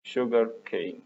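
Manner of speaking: ordinary delivery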